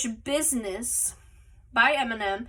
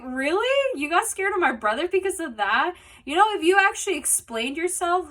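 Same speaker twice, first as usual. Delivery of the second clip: pitched-up disbelief at his fear; incredulous; 6 semitones higher than usual. Retold or imitated. Narrator scoffing at Cot for fearing her brother, higher incredulous tone. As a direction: act amazed he was scared, voice lifted. Teasing friend about being scared.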